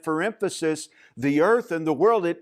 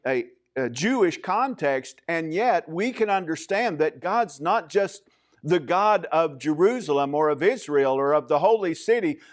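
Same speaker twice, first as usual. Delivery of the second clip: ordinary talk, nothing marked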